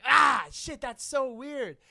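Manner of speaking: a loud retold "ah shit" of dismay; mock dismayed outburst. Telling a friend about the hookup, loud mock dismay. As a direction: blurt it out like the moment hit you. Recalling shock mid-hookup.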